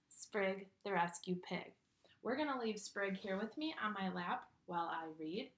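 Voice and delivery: usual speaking voice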